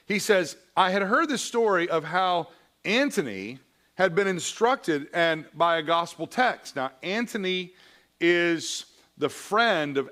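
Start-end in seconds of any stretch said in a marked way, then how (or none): 0.8-6.8 a lightly heightened reading voice; a preacher reading a memoir passage to his class; reading aloud. Acted. Reading Augustine's Confessions aloud.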